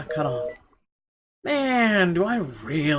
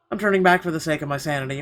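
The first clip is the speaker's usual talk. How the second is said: usual speaking voice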